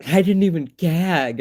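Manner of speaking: laughing triumph; gleeful boast. Retold or imitated. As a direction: crow it like a little victory. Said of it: "we were like".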